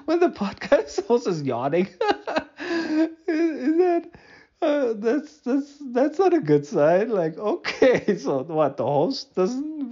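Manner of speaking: high-pitched laughter through the words; laughing. Real, not acted. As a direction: giggle helplessly while talking. Laughing at his own yawn.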